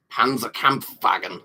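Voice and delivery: Panzerkampfwagen said slowly in a put-on German voice; mock German. Voicing a chatter's name, slow mock German. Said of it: "says Stug".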